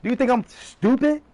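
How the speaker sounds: ordinary delivery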